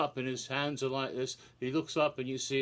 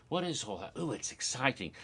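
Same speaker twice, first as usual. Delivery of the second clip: normal talking voice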